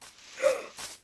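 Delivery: sharp high gasp; startled gasp. Real, not acted. Gamer startled in the dark, sharp gasp. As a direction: gasp in sudden fright.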